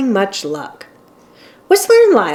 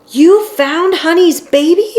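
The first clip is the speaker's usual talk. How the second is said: high, louder, astonished question; excited cry; 9 semitones higher, 5 dB louder than usual. A narrator's reading. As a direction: burst out in amazed joy. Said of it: "cried the whale".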